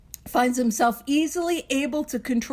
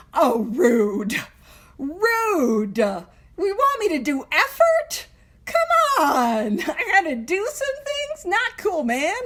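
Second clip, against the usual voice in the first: mock whiny complaint; 4 semitones higher, slower than usual. Mock complaint about required effort.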